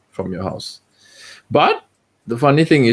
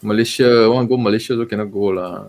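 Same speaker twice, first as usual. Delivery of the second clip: drawled Singlish with lah and lor; laid-back Singapore Creole English; faster than usual. Acted. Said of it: "We will talk in Singlish".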